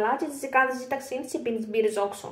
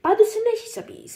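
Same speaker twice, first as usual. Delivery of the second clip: excited gossip; 11 semitones higher, 7 dB louder than usual. Acted. Gossip exclamation.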